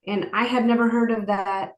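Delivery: ordinary delivery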